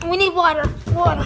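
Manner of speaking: loud urgent calls; calling for water. Real, not acted. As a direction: shout for water, mouth burning. Mouths burning from sour candy.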